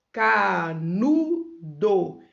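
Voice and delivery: CANUDO drawn out, ca-nu-do; split into syllables. Shown as a demonstration. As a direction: say Canudo very slowly in three beats. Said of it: "eu abri a boca três vezes".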